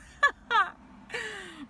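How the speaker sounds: high, loud burst of laughter; big cackling laugh. Real, not acted. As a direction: crack up at your own scolding. Laughing after mock telling-off of friend.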